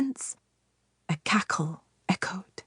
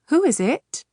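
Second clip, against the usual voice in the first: raised voice, higher; calling out; 6 semitones higher, 4 dB louder than usual. Acted. Husband calls out at a knock on the door. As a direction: call toward the door, raised and high. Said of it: "Samar called".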